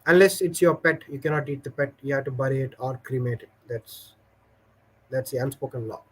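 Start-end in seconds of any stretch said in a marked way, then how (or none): none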